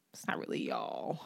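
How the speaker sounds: breathy whisper; whispered aside. Real, not acted. Dissing the group's credit, a whispered aside. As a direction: whisper it like a secret.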